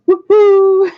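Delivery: high, loud woohoo; celebratory whoop. Real, not acted. Streamer celebrates graduating college, celebratory whoop.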